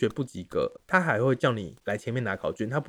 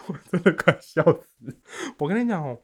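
laughing; 6 semitones higher, 7 dB louder than usual. Real, not acted.

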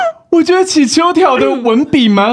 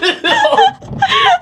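high laugh. Real, not acted. Host laughs.

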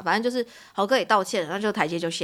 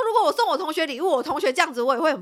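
daughter's voice; 7 semitones higher than usual. Retold or imitated. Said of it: "她说".

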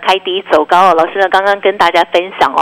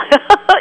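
high giggle; 11 semitones higher than usual. Real, not acted. Host laughs at overrun.

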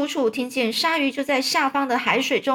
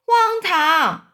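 high, loud dismissive cry; raised voice of indignant disbelief; outraged chorus; 8 semitones higher, 5 dB louder than usual. A narrator's reading. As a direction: exclaim it like a scoffing crowd. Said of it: "大家全部异口同声地说".

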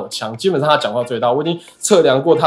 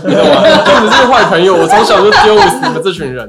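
笑着高声说; 边笑边损朋友，声音明显更高更响; laughing; 7 semitones higher, 9 dB louder than usual. Real, not acted. Joking jab at friends. (笑着骂朋友)

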